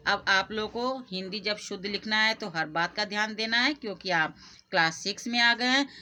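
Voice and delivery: ordinary talk, nothing marked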